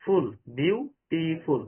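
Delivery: "ফুল" alone, then "বিউটিফুল" as বিউ, টি, ফুল; "বিউটিফুল" drawn out syllable by syllable; three syllables. Shown as a demonstration. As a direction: slowly separate the beats of "বিউটিফুল". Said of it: "There are three syllable".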